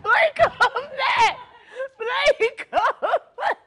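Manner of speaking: shouting after someone; calling out. Real, not acted.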